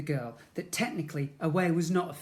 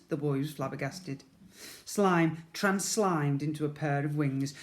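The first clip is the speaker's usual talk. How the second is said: ordinary delivery, nothing marked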